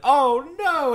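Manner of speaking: high, loud drawn-out wail; hammed-up cry of dismay; mock despair. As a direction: wail it like a tragic hero. Heartbroken explorer's cry, acted out.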